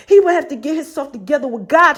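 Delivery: ordinary delivery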